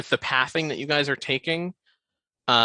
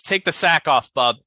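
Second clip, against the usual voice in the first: louder, sharp thug command; barked order; 4 semitones higher, 7 dB louder than usual. Acted. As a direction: bark it at him.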